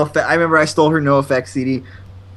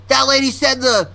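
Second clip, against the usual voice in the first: higher-pitched, indignant customer impersonation; complaining customer; 7 semitones higher than usual. Retold or imitated. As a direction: argue like a customer who feels cheated.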